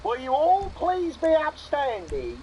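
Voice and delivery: hammed-up Aussie roll call, slow and high; mock Australian accent. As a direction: play a cartoon Australian host, drawn out. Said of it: "the wheels have come off the accent".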